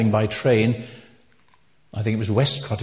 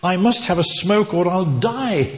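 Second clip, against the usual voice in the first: high-pitched mock-desperate plea; desperate blurt; 7 semitones higher than usual. Smoker begging the college principal, acted desperation. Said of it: "in desperation ... he blurted out".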